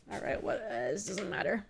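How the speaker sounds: whispered to self; whispered aside. Real, not acted. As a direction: mutter it under your breath. Aside to game.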